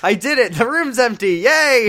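high, bright, cheering yay; mock cheer. Mocking Adrian cheering over the empty room, high and loud. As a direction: gush like it's a party.

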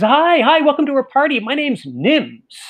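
high, eager welcome; an excited teen welcoming strangers; enthusiastic greeting. Retold or imitated. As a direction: bounce up and greet the guests. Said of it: "all enthusiastic".

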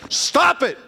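loud scolding command; sharp rebuke. Real, not acted. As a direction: snap it out as a rebuke. Preacher rebukes congregation.

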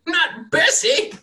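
a farmer hearing a bang in his barn; panicked farmer. Acted. Farmer fears for his cow, high frantic cry. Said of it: "and he's like".

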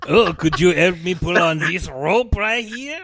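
mock French Canadian accent; Patrice Bergeron. Mime asking for help with rope, French Canadian accent. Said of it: "pitch perfect French Canadian accent".

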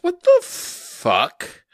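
high, drawn-out mock disbelief; hammed-up slow shock; appalled. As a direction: stretch it out in loud astonishment. Retold shock at the dress.

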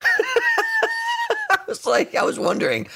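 giggling through the words; laughing. Real, not acted. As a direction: laugh while you say it. Laughing at the impression.

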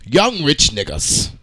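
usual speaking voice

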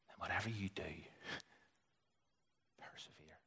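hushed whisper, fading out on "persevere"; whispered plea. Retold or imitated. Pastor pleading with someone who wants to quit, whispering. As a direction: breathe the last word.

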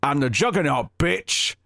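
brash catchphrase quote. Retold or imitated. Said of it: "the first thing that popped out".

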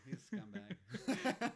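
drawn-out laughing scumbag; slow, amused jab through laughter. Real, not acted. Teasing his friend about a villain, laughing.

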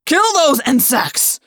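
slow, loud raging command; screamed order. Acted. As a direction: drag out each word in fury. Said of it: "Visser Three screamed".

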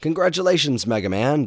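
put-on bright elderly mentor voice; Doctor Light voice.

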